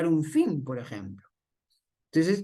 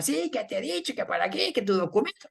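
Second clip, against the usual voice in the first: neurotic outburst. Acted. Policeman losing it with a driver, mock neurotic. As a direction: act out a fussy, worked-up fit at reduced volume. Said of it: "no hace crisis neurótica".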